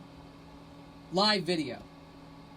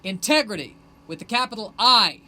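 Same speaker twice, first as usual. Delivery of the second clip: loud proclamation; 3 semitones higher, 10 dB louder than usual. Real, not acted. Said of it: "proclaiming artistic integrity".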